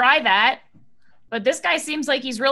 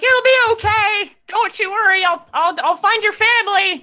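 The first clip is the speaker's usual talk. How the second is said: high, raised voice comforting someone; called-out reassurance; 9 semitones higher, 4 dB louder than usual. Acted. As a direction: call out urgently as you are pulled away. Player calls reassurance while fading away.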